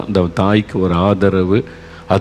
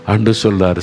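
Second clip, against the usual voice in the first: ordinary delivery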